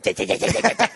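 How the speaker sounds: loud, rapid-fire tongue clatter; imitated machine-gun noise. Comic making gun noises in woman's face, imitated rattle. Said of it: "made these machine gun noises".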